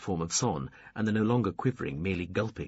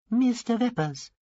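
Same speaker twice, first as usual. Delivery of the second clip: high alien voice; 14 semitones higher, 5 dB louder than usual. A narrator's reading. Tiny alien's first greeting.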